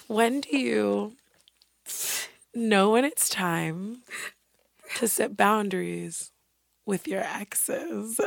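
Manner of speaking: soft, drawn-out mock question; imitating slow questioning. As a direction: mimic them taking their time, phrase by phrase. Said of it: "And you took your time. You said".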